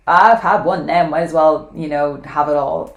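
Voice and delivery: hammed-up indulgent voice. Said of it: "Self-indulgent is that kind of like".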